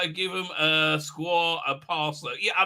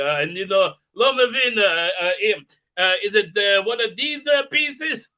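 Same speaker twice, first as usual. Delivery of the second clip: put-on halting Israeli accent, louder; 4 semitones higher, 7 dB louder than usual. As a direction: fake broken English in an Israeli accent. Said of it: "put on his, uh, Israeli accent".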